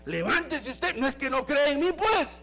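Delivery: hammed-up rebuke, rising to a shrill yell; mock scolding. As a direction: play a harsh Jesus telling her off. Imagined harsh Jesus scolding Mary.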